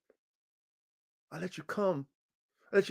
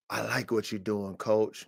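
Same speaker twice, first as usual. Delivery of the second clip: soft, lower, measured compliment; low-key buyer praise; 4 semitones lower, 8 dB quieter than usual. Acted. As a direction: quote the buyer quietly, holding back. Buyer praising coach, first take.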